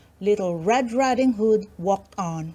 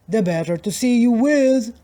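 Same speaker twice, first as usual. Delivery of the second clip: louder sly wolf; wolf in disguise answers the girl, louder; 3 semitones higher, 5 dB louder than usual. Acted. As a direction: purr it like the wolf in the bed.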